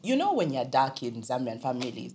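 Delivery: normal talking voice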